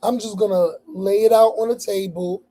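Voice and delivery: ordinary talk, nothing marked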